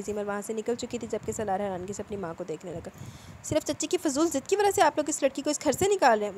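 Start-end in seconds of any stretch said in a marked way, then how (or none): 3.4-6.4 higher-pitched, heated protest; mildly acted angry accusation from a son. A narrator's reading. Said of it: "सुर्ख चेहरा लिए गुस्से से बोला". (गुस्से भरा हल्का विरोध)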